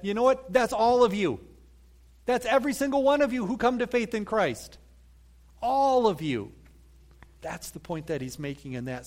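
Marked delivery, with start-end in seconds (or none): 0.5-6.5 "all" pressed, a touch louder, urging; "all" पर हल्का ज़ोर देकर समझाओ; stress on all. Shown as a demonstration. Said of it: "the emphasis there is that".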